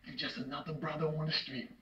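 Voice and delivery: low, soft finality after the outburst; quiet dismissal. Acted. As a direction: drop down and write him off. Father's cold final dismissal.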